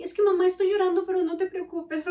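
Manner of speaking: voz de niña, más aguda y rápida; hija emocionada. Retold or imitated. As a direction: imita a la hija que habla deprisa entre lágrimas. Hija de once años al teléfono. (la hija de once años, aguda y acelerada)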